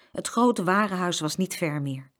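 ordinary delivery

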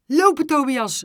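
loud, high command; urgent shout. A narrator's reading. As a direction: yell at the pupil to move. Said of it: "riep hij".

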